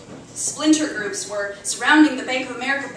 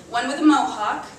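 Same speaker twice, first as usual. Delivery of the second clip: ordinary delivery, nothing marked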